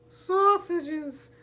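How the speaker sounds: put-on very high-pitched gleeful toddler whoop. A narrator's reading. Little Treasure asked what he wants for dinner, whooping. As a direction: squeal it out in one long whoop. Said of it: "he whooped".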